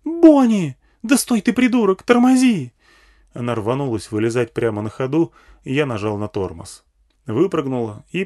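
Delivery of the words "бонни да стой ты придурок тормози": very high, loud, frantic shrieking; woman's scream. A narrator's reading. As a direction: scream at the driver to brake. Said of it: "завопила женщина".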